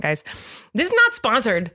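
ordinary delivery

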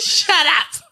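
high, loud mock shout; imitated shut up. Copying the movie girl to a friend, mock shout. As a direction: copy her snap, pitched high and loud. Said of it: "And then she goes".

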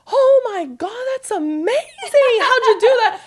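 high-pitched, gushing mock amazement; amazed mom. Mother hearing her daughter summited Everest, retold with excited amazement. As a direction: imitate a thrilled mother, stretching the praise. Retelling mom's first reaction.

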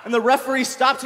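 ordinary talk, nothing marked

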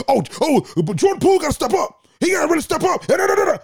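high, loud mock screaming rant; Stephen A. Smith screaming. Pundit screaming his answer to a calm question, high and loud. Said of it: "Bro, what are you screaming for?".

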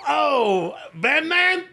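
high, excited Long Island fan; Long Island accent. Acted. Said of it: "that's a Long Island accent".

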